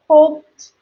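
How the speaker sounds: hoped, ending a short t, one syllable. Shown as a demonstration. Teacher modelling hoped for learners, t ending. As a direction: say hoped as hopt, no extra syllable. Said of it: "hoped is not ending with a, you know, E D".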